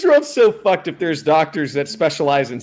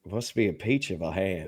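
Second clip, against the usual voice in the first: deep soft Southern aristocrat voice; Southern aristocrat accent; 7 semitones lower, 10 dB quieter than usual. Retold or imitated. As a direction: purr it like a Southern gentleman. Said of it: "Southern aristocrat".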